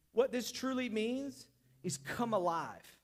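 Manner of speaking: normal talking voice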